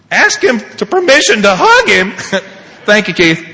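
high, loud scoffing echo; indignant disbelief. Retold or imitated. Father scoffing at his sponsor's instruction, acted loud disbelief. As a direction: repeat the advice in mock outrage.

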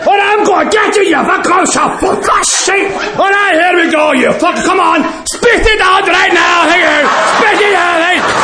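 high, raging mock shouts; furious Scot. Scottish drunk yelling at the fly to spit out his beer, high furious shouts. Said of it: "The guy from Scotland is pissed".

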